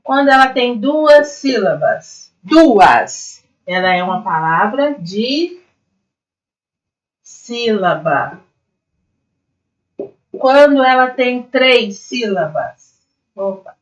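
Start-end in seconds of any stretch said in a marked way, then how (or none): 5.0-8.5 "de sílaba" with a long pause after "de"; split term. Shown as a demonstration. Teacher naming a two-syllable word, "de sílaba" slowly split in two. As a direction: hang on a rising "de", then slowly give "sílaba".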